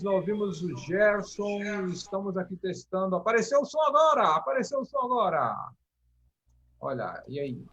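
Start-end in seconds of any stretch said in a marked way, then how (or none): 3.1-5.7 excited. Real, not acted. Sound finally works on stream.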